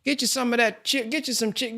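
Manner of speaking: high, a hint of a generous mother; lightly voiced mother. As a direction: hand it over like a giving mom. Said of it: "she just give you".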